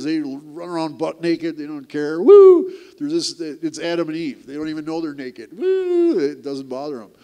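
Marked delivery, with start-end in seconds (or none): 2.2-2.7 very high, loud playful whoop; gleeful childish yell; carefree whoop. Pretend. Mimicking carefree naked toddlers. 5.6-6.2 very high, loud playful whoop; gleeful childish yell; carefree whoop. Pretend. Mimicking carefree naked toddlers.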